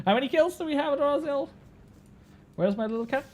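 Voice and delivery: ordinary talk, nothing marked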